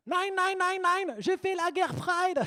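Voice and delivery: put-on very high, slow comic German accent; hammed-up German accent, stretched at the end. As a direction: shriek it in a bad German accent. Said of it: "mon accent allemand".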